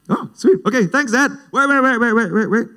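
high-pitched hammed-up delight, then alarm; excited relief. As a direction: thank dad brightly, then frantically stop him. Acts out reacting to dad's approval.

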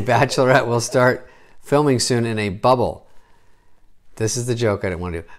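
ordinary delivery

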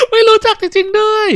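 very high, loud put-on voice; crowing character voice. Game character to friend who doesn't know, high crowing. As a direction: crow it way up high. Character crows at ignorance, acted.

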